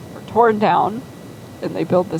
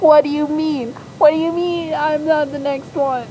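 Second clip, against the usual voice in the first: higher, indignant whine; whiny fit; 9 semitones higher than usual. Acted. Zug upset he is not the next name, whiny fit. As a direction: throw a petulant tantrum.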